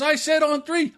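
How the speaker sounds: high-pitched imitation of an indignant dad; mock arguing yell. Bleeding father correcting his son, high indignant yell. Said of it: "he's yelling back and forth".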